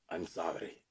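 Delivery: put-on low, soft, manly stab at a Mexican accent; botched Salma Hayek accent. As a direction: badly attempt her accent, coming out manly. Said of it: "That was super manly".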